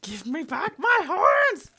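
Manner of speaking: hammed-up roar for the lost horns; shouted demand. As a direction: bellow it like an angry demon.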